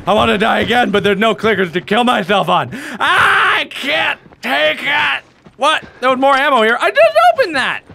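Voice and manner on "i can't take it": slow, high drawn-out cry; exasperated wail. Real, not acted. Player lost with no way out, exasperated wail. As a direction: stretch every word into a wail.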